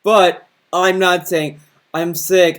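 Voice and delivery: normal talking voice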